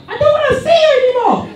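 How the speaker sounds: very high mock-girl voice; arguing girl. Girl rejecting him when lights went off, high mocking squeal. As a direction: mimic her rejection in a squeal. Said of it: "she was like".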